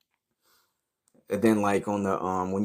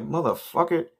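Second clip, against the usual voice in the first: heated retort; 9 semitones higher than usual. Retold or imitated. His retort to friend.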